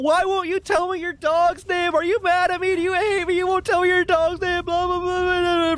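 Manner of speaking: very high, frantic imitation of a panicking date; mock freak-out. Date demanding his dog's name, freaking out in mockery. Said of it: "She starts freaking out".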